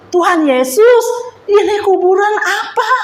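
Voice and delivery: mild alarm, replaying her own fearful prayer; retold frightened plea. Speaker asks Jesus about a grave in a vision, retold fear. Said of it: "Semakin saya takut dong".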